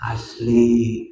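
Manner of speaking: normal talking voice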